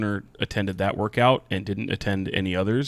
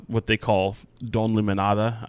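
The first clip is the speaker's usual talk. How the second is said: usual speaking voice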